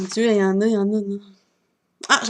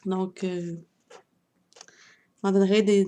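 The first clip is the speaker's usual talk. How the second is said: ordinary talk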